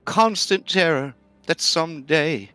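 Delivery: put-on a frightened old tree near tears; sobbing talking tree. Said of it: "it starts to sob and tree".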